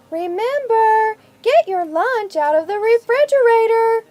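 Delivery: mom's note voice. A narrator's reading. Mom's note reminding Gino about lunch, in a high motherly voice. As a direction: read it like Mom's sing-song reminder.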